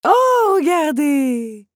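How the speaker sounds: high, loud, drawn-out cry; delighted exclamation, stretched slow; sudden excitement. A narrator's reading. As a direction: burst in, thrilled, pointing out a discovery. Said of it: "intervint soudain la comtesse".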